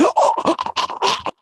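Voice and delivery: high airless death gasp; strangled gasp; dying animal gasp. Shown as a demonstration. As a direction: imitate an animal's last gasp with no voice. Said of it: "That's how your dog would sound".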